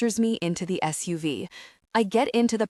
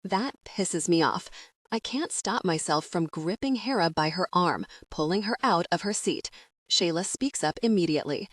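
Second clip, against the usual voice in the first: normal talking voice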